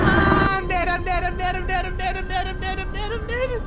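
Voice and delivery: high frantic chant of I'm dead; shrieking in terror; panicked wailing. Real, not acted. Player wails as the Leviathan kills him.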